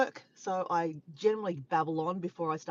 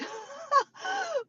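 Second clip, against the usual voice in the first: sudden high giggle after the joke; high laugh. Real, not acted. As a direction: giggle at your own gag. Laughs at own Batman joke.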